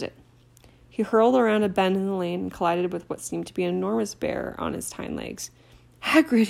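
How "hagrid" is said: out of breath. A narrator's reading. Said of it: "he panted".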